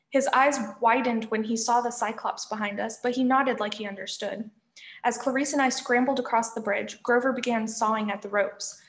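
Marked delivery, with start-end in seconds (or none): none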